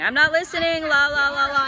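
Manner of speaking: woman plugging her ears against God, high defiant chant; refusing to listen. Acted. High, childlike blocking-out chant. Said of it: "analogy of plugging your ears and going".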